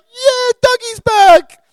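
high, loud, drawn-out crowd cheer; rowdy bar cheer. Retold or imitated. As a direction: roar it like a bar full of regulars. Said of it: "everyone's like".

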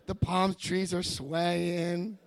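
very slow, drawn-out words; dreamy enchantment. Retold or imitated. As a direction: stretch it out as if entranced. Liquor store as heavenly vision.

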